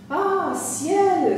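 high, loud dramatic exclamation; exclaimed cry. A narrator's reading. Landlady seeing a storm coming, high exclaimed cry. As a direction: cry out in theatrical alarm. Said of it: "s'écria ma fermière".

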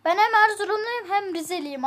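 usual speaking voice

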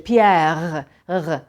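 "Pierre" drawn out with a guttural final R; low throaty R in "Pierre", no trill; French R. Shown as a demonstration. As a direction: end "Pierre" in the back of the throat. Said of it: "Fransızca'da işte".

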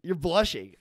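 playful sing-song tease; teasing. Acted. Teasing her about blushing.

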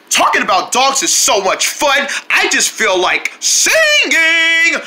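normal talking voice

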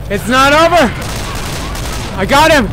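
high, loud and determined; urgent gamer shout. Real, not acted. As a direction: call it out over the fight. Fighting on during escape.

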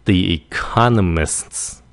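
economists slowly with a hissy s cluster at the end; hissed ending. Shown as a demonstration. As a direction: draw out the final S sounds of "economists". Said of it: "xì xì nó một cái".

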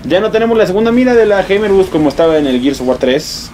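ordinary talk, nothing marked

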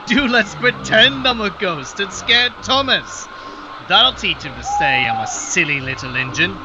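high, eager, playful plotting; a cheeky young engine; mischievous little engine. A narrator's reading. Percy plotting a prank.

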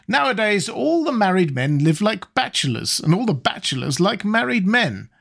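a hint of a bossy old lady; Lady Narborough voice.

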